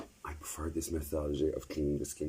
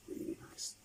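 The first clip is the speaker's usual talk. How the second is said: whispering; 10 dB quieter than usual. Real, not acted. Whispered aside about mask.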